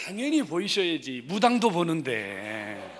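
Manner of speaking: very loud, stretched-out final word; booming teasing retort. Real, not acted. Preacher chides elder who should see it, booming. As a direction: boom it out, slowing and dragging the end.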